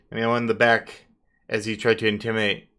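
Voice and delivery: ordinary delivery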